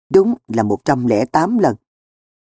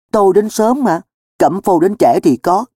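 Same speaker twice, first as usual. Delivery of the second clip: higher-pitched sulky retort; mildly huffy protest; 6 semitones higher than usual. Acted. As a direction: throw the blame back, miffed. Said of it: "Tôi giận dỗi".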